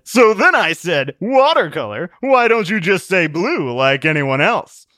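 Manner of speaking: high, loud, slow storytelling voice; amused raconteur. A narrator's reading. Worldly Wiseman tells Truth his joke, amused and hearty. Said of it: "Laughing, Worldly Wiseman said".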